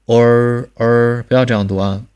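哦儿 with the tongue curled back; wrong erhua. Shown as a demonstration. (错误的儿化读法)

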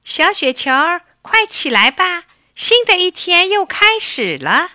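high, lively wake-up call; motherly rousing call, pitched well up. A narrator's reading. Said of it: "妈妈喊它".

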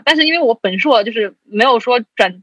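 usual speaking voice

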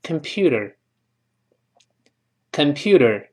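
"computer" with a d-like flapped t; softened t. Shown as a demonstration. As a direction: say "computer" slowly as "compyooder". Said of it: "在这浊化成的的声音".

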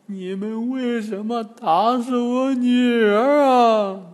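high, slow, drawn-out wail; old granny. A narrator's reading. Granny accusing monks over daughter, high slow wail. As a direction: wail it loudly as an old woman. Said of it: "那姑娘又变成老婆婆".

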